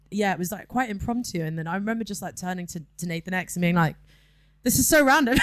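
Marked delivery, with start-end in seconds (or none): 4.6-5.4 high, loud, laughing disbelief; amazed, voice jumping up; excited retold aside. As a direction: call it out over the music, giddy. Retold yell to DJ partner mid-set.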